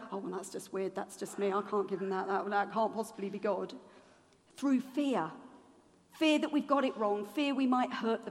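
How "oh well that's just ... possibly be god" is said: self-doubting mumble. Retold or imitated. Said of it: "'cause you go".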